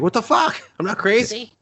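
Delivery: shrill hammed-up protest; high mock panic. Panicked hero protesting that he is sane, high mock panic.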